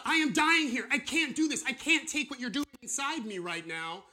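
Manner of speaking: high, loud, strained complaint; crying out to God. Retold or imitated. Believer crying to God in distress, high loud strain. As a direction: cry out as if in agony.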